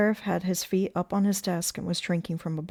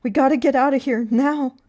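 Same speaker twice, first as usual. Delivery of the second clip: high, loud, pressing; frantic raised voice; urgent alarm; 7 semitones higher, 10 dB louder than usual. A narrator's reading. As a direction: urge an immediate escape. Urging lover to flee danger.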